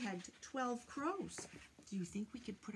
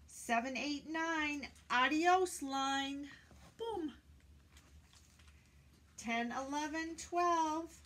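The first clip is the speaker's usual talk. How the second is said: cheery, louder counting with a high bright farewell; lively counting; 5 semitones higher, 7 dB louder, slower than usual. Pretend. Children's host counting crows away, bright and loud. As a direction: count them off and wave goodbye.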